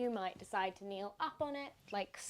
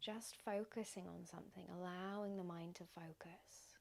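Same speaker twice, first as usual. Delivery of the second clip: soft, unhurried meditation voice with long pauses; guided meditation; 7 dB quieter than usual. Acted. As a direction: gently guide listeners into stillness, leaving space between phrases.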